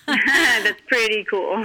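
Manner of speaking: slow and laughing through it. Real, not acted. Guest laughing back at the host's joke, slow and amused. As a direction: stretch it out on a laugh. Repeats her remark laughing.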